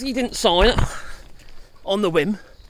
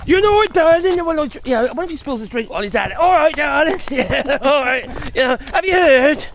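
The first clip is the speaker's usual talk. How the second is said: higher, louder, gushing put-on voice; mock Hegseth pillow talk; 4 semitones higher, 6 dB louder than usual. Hegseth boasting secrets to his wife in bed, mock gushing voice. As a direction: ham up a boastful husband telling his darling. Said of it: "a bit like that".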